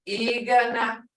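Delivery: "Igana" with a stretched opening ee; long toned vowel. Shown as a demonstration. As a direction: hold the tone on "Igana". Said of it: "you can hear that tone".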